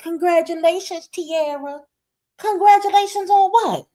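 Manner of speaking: high, loud, incredulous jab; mock congratulations. Vlogger mimicking congratulations in the chat, mockingly high.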